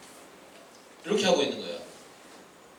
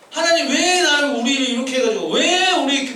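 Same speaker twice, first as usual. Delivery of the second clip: acted complaining; 6 semitones higher than usual. Preacher voicing believers questioning God, whiny complaint. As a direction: grumble at God in mock protest. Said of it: "불평한다는 거지".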